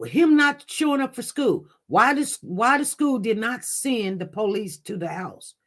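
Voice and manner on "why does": normal talking voice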